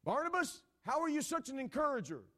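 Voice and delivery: high, loud rhetorical question; calling out. Acted. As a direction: call to Barnabas as if across the room. Calls out question to Barnabas.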